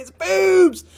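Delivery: high, loud blurt of delight; gleeful shout. Real, not acted. Host shouting to viewers what the rock looks like, loud gleeful blurt. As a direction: burst out laughing-loud, finally allowed to say it.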